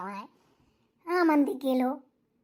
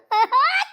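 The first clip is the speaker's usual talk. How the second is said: loud high laugh; 9 semitones higher, 9 dB louder than usual. Acted. Laughing burst after scolding.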